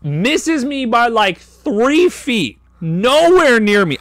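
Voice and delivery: very high, drawn-out disbelief; incredulous emphasis. Real, not acted. Telling how the rival's kick missed, high incredulous mockery. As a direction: stretch it high to ridicule the miss.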